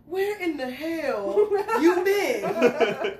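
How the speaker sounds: high, very drawn-out dad's demand; imitating dad. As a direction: stretch each word in comic disbelief. Imitates dad questioning her after mudding.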